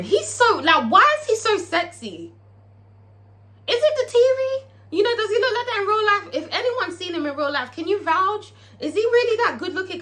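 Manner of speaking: high-pitched, gushing disbelief; swooning. Real, not acted. Fan asks whether [name] really looks that good, gushing. Gushes over how sexy [name] is.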